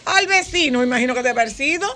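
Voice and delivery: high, loud mock puzzlement; sarcastic. Real, not acted. Host teasing parents about whom the child takes after, sarcastically.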